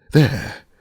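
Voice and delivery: the peak of a despairing cry; a high, falling, despairing there; passionate cry. A narrator's reading. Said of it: "he cried".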